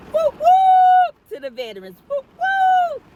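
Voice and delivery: high, loud whoop whoop; whooping cheer. Real, not acted. Cheering the veterans on Veterans Day, high loud whoops. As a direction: cheer them on with a big whoop.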